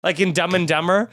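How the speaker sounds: ordinary talk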